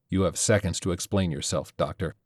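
usual speaking voice

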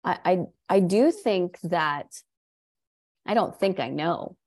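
normal talking voice

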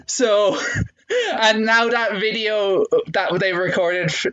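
normal talking voice

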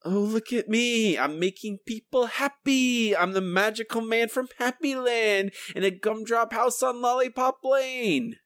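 high mock-cheery sarcasm; sing-song sarcasm, laying it on thick; sarcastic. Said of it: "I was being sarcastic".

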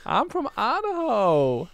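put-on high, drawn-out Idaho accent; mock Idaho accent. Proud local saying where they are from, mock Idaho accent. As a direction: stretch it slowly in a hammed-up Idaho accent. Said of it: "this is my Eastern Idaho accent".

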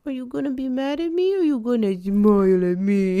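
put-on quick, teasing little-kid question; lightly put-on kid voicing; playful imitation of a cheeky look; voicing the toddler's look. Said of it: "he just looks at you like".

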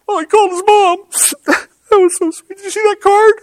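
very high, gushing, tearful falsetto; mock self, sobbing over Hallmark in falsetto; sappy crier. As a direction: gush through tears at a TV ad. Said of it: "happy ending T V commercials".

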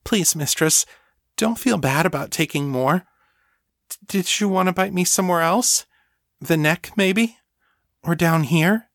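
high, halting, anxious offer; worried. A narrator's reading. Thrall urging her mistress to feed, worried. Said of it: "sounding worried".

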